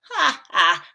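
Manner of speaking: loud mock ha ha; a cocky read-aloud laugh. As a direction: gingerbread man laughing off the old man's help. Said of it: "The gingerbread man laughed".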